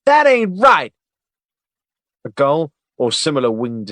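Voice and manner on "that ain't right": loud, high, slowed shout upward; shouting at the sky. Acted. Agent yelling at the gulls overhead, furious shout. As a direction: yell up at the birds, furious. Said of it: "he shouted at the sky".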